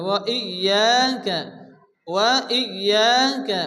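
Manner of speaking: ওয়া ইয়াকা slowly, ya pressed and held; doubled y. Shown as a demonstration. Teacher demonstrating the shadda again. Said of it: "এক আলিফ পরিমাণ টান দিয়ে পড়তে হবে".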